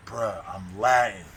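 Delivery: slow, heavily drawn-out words, imitating him; emphatic Latin man. Latin inmate explaining why he talks loud, mock emphatic. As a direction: stretch each word out for emphasis. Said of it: "We talk loud with a lot of emphasis".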